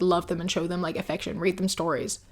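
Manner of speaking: normal talking voice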